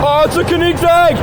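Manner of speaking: high, thrilled exclamation; excited cry. Real, not acted. Car spotter sees a Koenigsegg, excited cry. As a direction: cry out at a rare sighting.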